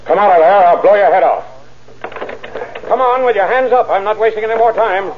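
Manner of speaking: shouting orders. Acted. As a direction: yell it at the car to come out. Calling out armed suspect.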